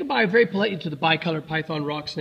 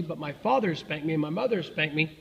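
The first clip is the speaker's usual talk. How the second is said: ordinary delivery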